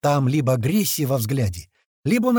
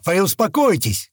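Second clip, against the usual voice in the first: raised, higher-pitched order; shouted command; 5 semitones higher, 4 dB louder than usual. A narrator's reading. Man shouting at tense monkeys to calm down, raised command. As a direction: call out firmly to settle them. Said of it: "крикнул я обезьянам".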